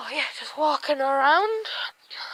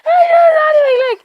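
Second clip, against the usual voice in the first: high, loud laughing cry; laughing shout; 10 semitones higher, 9 dB louder than usual. Real, not acted. Laughing at the dog wedged under her leg, loud and high. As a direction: yelp it out through laughter.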